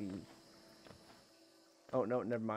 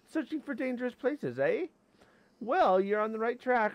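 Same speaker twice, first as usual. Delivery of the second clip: high-pitched little critter voice; high, chirpy creature role; friendly tiny creature; 11 semitones higher, 6 dB louder than usual. Acted. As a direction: read it as a small creature greeting an adventurer. Reads creature's game dialogue.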